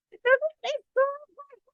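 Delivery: high giggling through the words; cracking up mid-sentence; laughing. Real, not acted. Vlogger laughing about the man's bright teeth, giggly.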